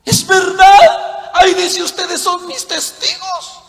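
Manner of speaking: high, loud, drawn-out mock amazement; astonished realization. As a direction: play the dumbstruck believer discovering it. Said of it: "¿Cómo se queda el católico?".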